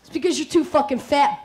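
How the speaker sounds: normal talking voice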